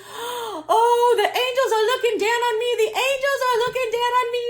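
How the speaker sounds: high, loud, fast and close to crying; tearful joy. Retold or imitated. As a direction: mimic her overwhelmed near-sobbing gratitude. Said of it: "And she goes".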